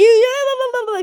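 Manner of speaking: high, loud laugh through the words. Real, not acted. Laughs mid-sentence.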